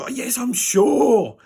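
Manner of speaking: high, drawn-out exclamation of certainty; exasperated insistence. Retold or imitated. Patient answering the doctor's check, exasperated. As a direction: protest it high and slow, voice swelling.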